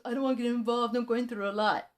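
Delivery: mocking quote. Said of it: "so he goes".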